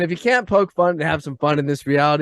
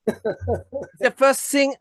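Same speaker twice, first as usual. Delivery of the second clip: put-on German accent; 10 semitones higher, much slower than usual. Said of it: "can you say that with a German accent?".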